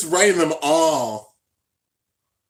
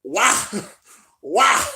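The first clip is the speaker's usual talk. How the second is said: loud, higher repeated cry; despairing why; 7 semitones higher, 6 dB louder, much slower than usual. Real, not acted. Fan protesting the boy reveal, loud repeated why. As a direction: wail each why at the screen.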